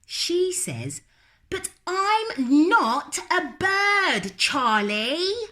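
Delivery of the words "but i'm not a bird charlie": put-on high, stubborn little-girl voice, louder; little girl refusing. Lola refusing to go to bed, high and stubborn. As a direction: dig in like a stubborn small child.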